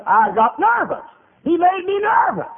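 high, loud mimicked outcry; worked-up nervous lady. Lady complaining about the tract man, high frantic cry.

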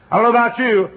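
"know" shouted very high and loud, falling at the end; shouted preaching. Acted. Preacher cries out his joy at the congregation, shouting. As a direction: shout it at the rafters.

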